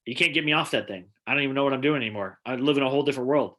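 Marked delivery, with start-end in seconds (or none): none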